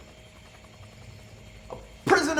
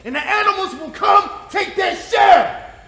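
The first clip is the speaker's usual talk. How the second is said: higher and louder, driving the threat home; menacing shout; 7 semitones higher, 4 dB louder than usual. Acted. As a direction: roar it like a promise. Interrogator threatens the prisoner.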